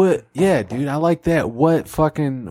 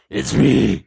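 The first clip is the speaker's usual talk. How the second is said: Batman voice; 3 semitones lower than usual. Acted. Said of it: "You still sound like Batman".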